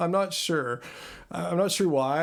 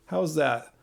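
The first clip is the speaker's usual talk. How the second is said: normal talking voice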